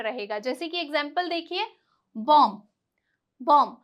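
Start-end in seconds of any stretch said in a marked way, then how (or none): 2.2-3.8 बॉम्ब with a silent b, just "bom"; pronounced bomb. Shown as a demonstration. Teacher showing students the silent b, clear and careful. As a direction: say बॉम्ब ending on m, no b. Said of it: "अब बहुत से लोग इसे क्या बोलते हैं".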